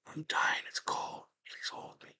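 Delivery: acted faint, fading whisper; dying whisper. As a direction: whisper it as a dying woman's last words. Voices the dying girlfriend.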